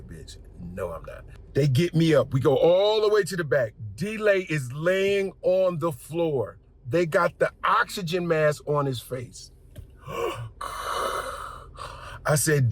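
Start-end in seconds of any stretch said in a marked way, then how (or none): 0.0-1.2 low, dry, muttered no; deadpan mutter to the flight attendant; muttered denial. Retold or imitated. His own retold reply. 10.1-12.2 breathy imitated gasp for air; mock gasp of a sick friend. Imitating D-Lay on oxygen.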